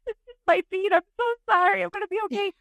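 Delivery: high, teary voice with a sob; imitating crying. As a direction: cry through an apology. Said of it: "I just started bawling".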